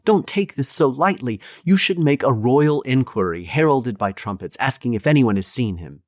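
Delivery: high, urgent plea of a worried daughter; pleading. A narrator's reading. Daughter begs father to act.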